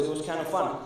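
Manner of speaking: ordinary talk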